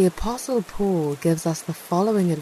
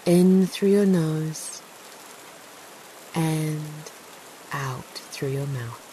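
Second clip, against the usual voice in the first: sleep story narrator. Acted. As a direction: ease the listener into stillness. Leads a guided meditation.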